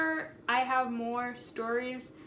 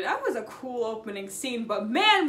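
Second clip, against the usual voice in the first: ordinary talk, nothing marked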